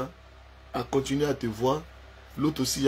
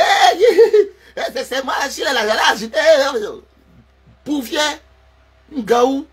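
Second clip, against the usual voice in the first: much higher, loud squealing mockery with laughs; mock shrieking ex; 10 semitones higher, 10 dB louder than usual. Man mocking his ex, high loud shrieking imitation. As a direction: shriek like a hysterical woman.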